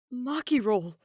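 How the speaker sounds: ordinary delivery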